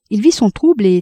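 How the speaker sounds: usual speaking voice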